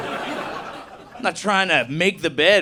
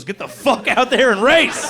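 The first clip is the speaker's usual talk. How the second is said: loud, slow, emphatic order; mock coaching yell; 5 semitones higher, 5 dB louder than usual. Dad ordering his kid onto the track, loud emphatic yell. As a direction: drive the kid on with a shout.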